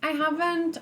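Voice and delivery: ordinary delivery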